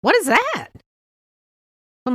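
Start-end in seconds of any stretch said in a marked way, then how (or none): 0.0-0.7 high, loud incredulous repeat; imitating a baffled customer asking again; the stranger again, loud and high in mock disbelief; mock shocked question. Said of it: "my daughter says".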